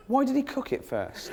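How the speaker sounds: high-pitched, puzzled outrage; mock-bewildered comic question; baffled disbelief. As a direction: ask it in leaping, incredulous pitch.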